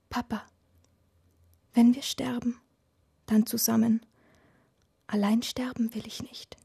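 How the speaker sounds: put-on breathless frightened child's whisper. A narrator's reading. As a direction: whisper it close, scared. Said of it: "und flüstert".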